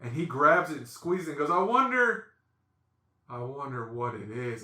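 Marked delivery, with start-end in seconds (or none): 1.5-2.3 high, raised sing-out of curiosity; playful wondering. Retold or imitated. Brother-in-law squeezes the package, high playful wondering. 3.3-4.6 lower, softer dawning dread; deflated realization. Retold or imitated. Brother-in-law realizes what's in the package, deflated muttering. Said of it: "his countenance changes".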